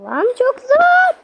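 high, loud, drawn-out complaint; frustrated wail. Real, not acted. Gamer failing a hard obby, frustrated. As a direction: stretch the last word into a high yell. Fails again at hard level.

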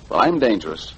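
ordinary talk

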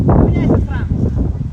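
loud, slow breathy call; yelling from the sidelines. Real, not acted. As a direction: shout the change across the pitch. Coach calling substitution. (крик с бровки)

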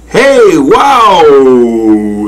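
high cry sliding down long; exclaiming shout. A narrator's reading. Good Lord landing on the long neck, whooping. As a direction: shout high, then let the last word fall away. Falling onto the giraffe's neck.

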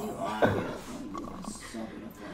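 whispered. Real, not acted. Idol answering softly, whispered.

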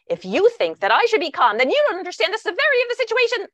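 indignant tirade, climbing high, loud and rapid; escalating outburst. Retold or imitated. Speaker snapping back at sister's calm-down, escalating outburst. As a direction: re-enact blowing up, speeding up as the pitch soars. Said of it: "I immediately escalated".

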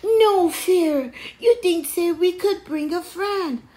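high, loud little-brother character; newcomer's voice. A narrator's reading. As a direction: call it out from down the path. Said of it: "said a voice coming down the path".

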